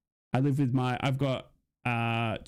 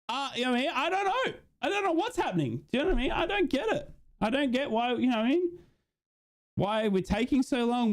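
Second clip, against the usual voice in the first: much higher, pleading rant that keeps climbing; exasperated whine; 8 semitones higher than usual. Real, not acted. Fan baffled the club hasn't signed anyone, whining high. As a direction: whine in disbelief that nobody is being signed. Fan venting about no signings.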